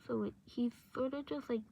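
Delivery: usual speaking voice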